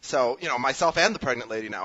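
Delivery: ordinary talk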